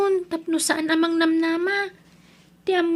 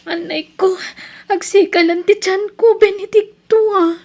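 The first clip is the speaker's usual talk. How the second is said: louder, higher, pained cry; 5 semitones higher, 6 dB louder than usual. Acted. Wife in labour calls to her husband in pain. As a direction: cry out as the contractions hit. Labour pains begin, cries out.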